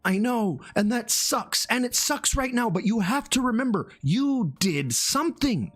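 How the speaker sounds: high, emphatic pep talk; earnest encouragement. Retold or imitated. Streamer encouraging a discouraged beginner, high and insistent. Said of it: "I have to convince them that they're".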